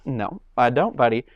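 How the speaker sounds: ordinary talk, nothing marked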